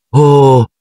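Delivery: un "Oh!" alto e sorpreso, più forte; esclamazione sorpresa. A narrator's reading. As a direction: Hermione colpita, esclama di getto. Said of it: "esclamò Hermione". (esclamazione acuta e sorpresa)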